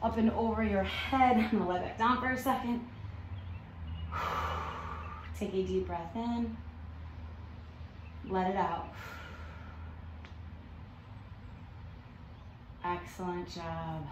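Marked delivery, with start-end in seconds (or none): 3.8-5.3 long exhausted exhale; tired breathy sigh. Real, not acted. Lying back after workout. 5.4-14.1 calm cooldown. Real, not acted. Cooldown breathing.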